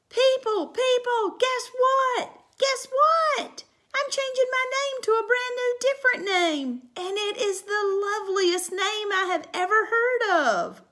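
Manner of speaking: high, loud, bursting with news; little girl bursting to tell her parents; excited little girl. Acted. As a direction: call out to the family, thrilled. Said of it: "excited child announces new name".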